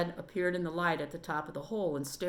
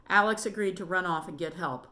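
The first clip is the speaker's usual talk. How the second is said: usual speaking voice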